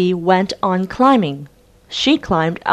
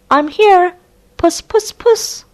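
much higher puss puss call; sing-song call to the cat; 12 semitones higher, 4 dB louder than usual. Acted. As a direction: Kate coaxes Tabby from the ladder. Kate calls the cat.

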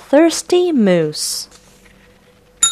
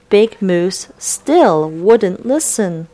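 plain reading voice

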